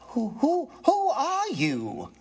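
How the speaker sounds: high, slow stuttering repeat of who; stammering in fear. A narrator's reading. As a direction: stammer it, scared but bracing. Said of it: "poising the poker in his hand".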